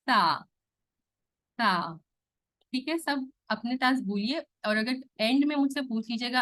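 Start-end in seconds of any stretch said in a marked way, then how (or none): 0.0-2.0 سا with the tongue tip on the front teeth, soft with no whistle; سا said softly, no whistling hiss. Shown as a demonstration. Teacher showing a soft سا to students. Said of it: "اس کو بہت soft ہونا ہے".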